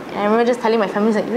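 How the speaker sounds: ordinary delivery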